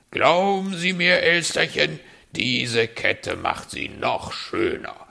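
flattering fox. Acted. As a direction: win her over with praise.

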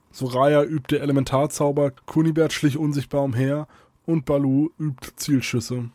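ordinary delivery, nothing marked

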